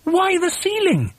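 very high, baffled question; high-pitched bewildered protest; astonished cry. A narrator's reading. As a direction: squeak it out in disbelief. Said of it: "they cried".

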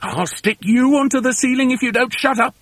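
high, irritated snap; high-pitched exasperated scolding; snapped threat. A narrator's reading. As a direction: silence the youngster with a threat. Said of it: "snapped Mugglewump".

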